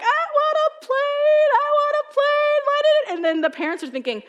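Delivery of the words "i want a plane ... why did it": put-on whining crying child. Child at the tower top wailing to parents, high crying whine. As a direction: wail it like a kid who missed out on a plane. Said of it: "and they're crying".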